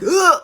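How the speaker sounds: short high-pitched mock scream; imitated scream. Girl grabbing something in the dark, imitated scream. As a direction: yelp it out as a startled demonstration. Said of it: "the scream that came out of me".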